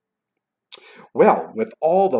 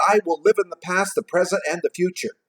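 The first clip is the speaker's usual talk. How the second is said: declaiming a vow in raised pitch; out loud; 8 semitones higher, 4 dB louder than usual. A narrator's reading. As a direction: proclaim it aloud, a touch louder. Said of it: "He says out loud".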